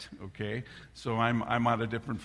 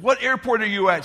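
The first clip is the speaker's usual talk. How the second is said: louder, higher, fed-up question; exasperated question; 8 semitones higher, 9 dB louder than usual. Retold or imitated. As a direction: demand it, finally losing patience. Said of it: "finally I said".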